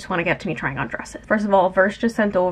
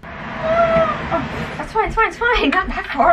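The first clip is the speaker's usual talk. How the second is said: high, quick it's fine; hurried reassurance, voice up; awkwardly making room for sister; flustered reassuring; 11 semitones higher than usual. Real, not acted. Squeezing past in cramped room.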